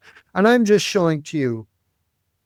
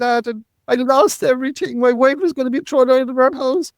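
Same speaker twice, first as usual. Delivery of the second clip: high, strained despair; pitched up high in panic; anguished inner thought; 9 semitones higher than usual. Retold or imitated. Retold despairing inner monologue.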